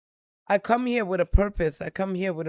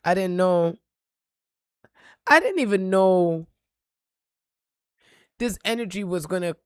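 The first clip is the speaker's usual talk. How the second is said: ordinary talk, nothing marked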